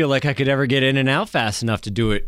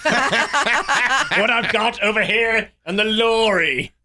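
The Rock; 9 semitones higher, much slower than usual. Acted. Co-host mimicking an actor for laughs, high fake British accent. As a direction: ham up a terrible British catchphrase, slowly. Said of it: "I'm The Rock doing a British accent".

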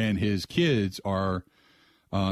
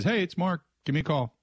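higher, odd caller voice imitated; weirdish voice; 6 semitones higher than usual. Retelling the eerie voicemail to the host, lightly imitated weird voice. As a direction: mimic the strange voicemail voice lightly. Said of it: "this weirdish voice".

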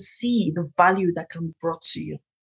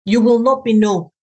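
usual speaking voice

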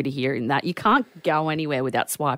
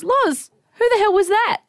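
higher, sharp shocked question; alarmed demand; 4 dB louder than usual. Retold or imitated. Secretary questioning Loz about the stranger, alarmed. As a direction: ring the alarm at her colleague.

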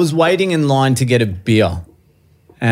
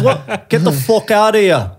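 imitated Irish brush-off, pitched up; Irish accent; 8 semitones higher than usual. Irishman tells the storyteller to clear off, imitated Irish accent. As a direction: send him packing in a mock Irish accent. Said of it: "in an Irish accent".

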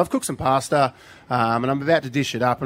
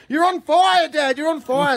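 put-on alarmed kid; 12 semitones higher, slower than usual. Dad imitating his kid spotting the fire, high alarmed cry. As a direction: sound the alarm like a scared kid. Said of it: "And Lenny's like".

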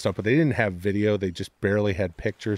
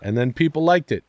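normal talking voice

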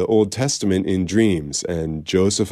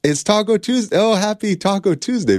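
much higher, bright sudden delight; 13 semitones higher than usual. Real, not acted. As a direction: squeal it with real glee. Host delighted at Taco Tuesday.

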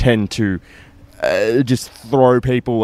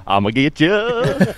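alien voice; 10 semitones higher, slower than usual. Pretend. Repeating the alien voice.

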